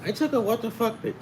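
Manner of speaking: high-pitched mock kid voice; imitating a youngster. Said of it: "if you're younger, you can't go in the house and say".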